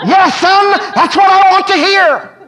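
a beaming father, laughing; voice of God. Retold or imitated.

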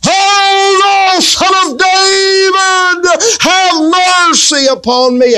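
very high, slow, wailing shout; desperate beggar shouting for mercy. Retold or imitated. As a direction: cry out even harder over the crowd. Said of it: "the more they talked to him like that".

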